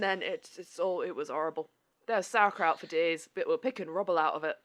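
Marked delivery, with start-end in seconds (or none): none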